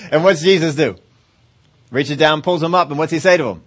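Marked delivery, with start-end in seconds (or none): none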